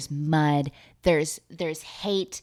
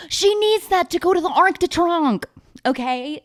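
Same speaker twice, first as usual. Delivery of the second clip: high, loud dramatic cry; gasp, then wail it high and loud; mock desperation; 12 semitones higher, 5 dB louder than usual. Mock alarm over losing the fee.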